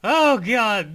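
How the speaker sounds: ordinary talk, nothing marked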